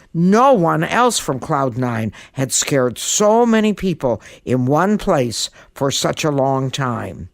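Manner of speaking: plain reading voice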